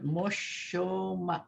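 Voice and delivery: Moshomak drawn out slowly, mo-sho-mak; slow teaching demo. Shown as a demonstration. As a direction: model the word slowly for learners. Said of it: "Very good".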